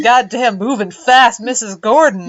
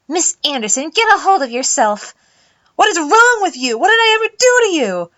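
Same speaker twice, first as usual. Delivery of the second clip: higher, rising distressed adult; upset teacher voice; 8 semitones higher, 3 dB louder than usual. Acted. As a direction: plead higher and higher. Adult breaks up hallway fight.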